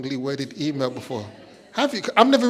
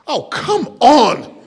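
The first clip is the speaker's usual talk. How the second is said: pleading groan; 7 semitones higher, 6 dB louder, much slower than usual. Real, not acted. Pleading with silent audience.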